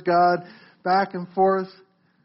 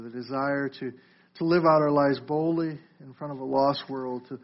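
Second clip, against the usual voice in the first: lower, softer praying voice; prayer; 8 semitones lower, 6 dB quieter than usual. Real, not acted. Preacher praying to the Father for the congregation, soft prayer. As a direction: lead a hushed, earnest prayer.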